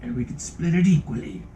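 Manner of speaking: the game master voicing the stranger offering gold. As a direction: pitch the job to the gnome. GM voices Silas.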